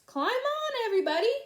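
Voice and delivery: high, loud call to everyone; shouting. A narrator's reading. Duck calls the animals aboard, shouting. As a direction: call out to the whole barnyard. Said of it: "shouted Duck".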